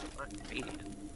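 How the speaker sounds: I'm not Canadian fades to an unvoiced whisper; trailing whisper. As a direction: let it die to a breathy aside. Repeated correction, voice drops away.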